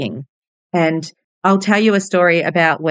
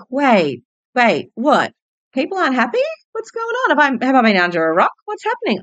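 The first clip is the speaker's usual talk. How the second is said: retold disbelief; 8 semitones higher than usual. Shocked realisation others aren't happy.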